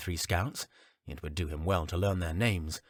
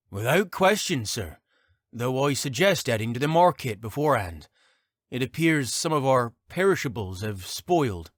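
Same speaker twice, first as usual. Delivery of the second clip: soldier voice; 5 semitones higher, 5 dB louder than usual. Acted. Scout Port speaks.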